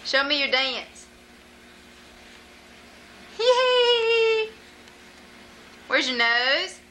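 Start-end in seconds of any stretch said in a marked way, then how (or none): 3.4-4.5 squeaky playful tee-hee; high giggle. Pretend. Mother giggling at her baby, high and squeaky.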